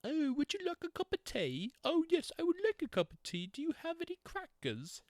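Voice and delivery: high, hammed-up British accent; high mocking British tea-party accent. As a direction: ham up a posh British accent. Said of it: "they got their little accent".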